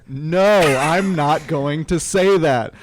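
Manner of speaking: loud, slow refusal, a real push of volume; announcer refusing to say the name, loud; retold scream. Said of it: "he just screamed".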